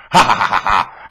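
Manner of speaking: spoken ha-ha jeer; mocking laugh. Preacher gloating over the doomed, a spoken mock laugh. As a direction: laugh it out in scorn.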